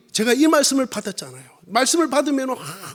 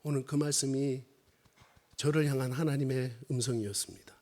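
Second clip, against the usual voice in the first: very low, soft and solemn; hushed testimony; 13 semitones lower, 15 dB quieter than usual. Real, not acted. Preacher shares God's word to himself, hushed testimony. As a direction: drop right down from preaching to confiding.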